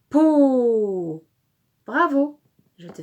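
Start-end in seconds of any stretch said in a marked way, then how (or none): 0.1-1.3 loud, stretched "Po" sliding downward; drawn-out syllable. Shown as a demonstration. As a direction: hold "Po" on a long falling glide. Model answer in syllable game.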